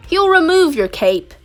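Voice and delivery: ordinary delivery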